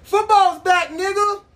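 high and loud, hyped yell; excited shout. Real, not acted. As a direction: shout it out, thrilled. Hyped about football season.

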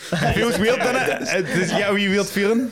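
high, giggly, amused; laughing. Real, not acted. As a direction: giggle through it. Laughing about saying four W's.

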